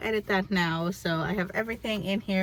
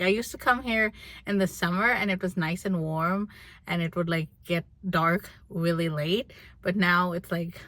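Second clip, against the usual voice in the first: ordinary talk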